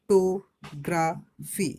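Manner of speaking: "Photography" very slow, in four loud beats; split into syllables. Shown as a demonstration. Teacher showing a polysyllable, "photography" slowly split in four. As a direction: break it up as ফ-টো-গ্রা-ফি, pausing between. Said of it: "তিনের বেশি, চার পাঁচ".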